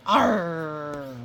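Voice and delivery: loud hammed-up pirate arr; pirate growl. Pirate Goose growls to his crew, loud mock arr.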